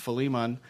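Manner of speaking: "Philemon" said fuh-LEE-mun; proper pronunciation. Shown as a demonstration. Preacher naming today's book, "Philemon" as fuh-LEE-mun. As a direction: say "Philemon" with a short, weak first syllable, fuh-LEE-mun. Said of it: "properly pronounced Philemon".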